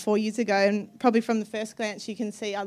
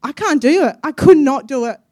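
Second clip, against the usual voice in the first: much louder, higher, anguished; desperate cry; 4 semitones higher, 9 dB louder than usual. Acted. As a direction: cry it out in despair. Acting out her brokenness.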